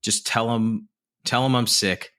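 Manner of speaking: ordinary delivery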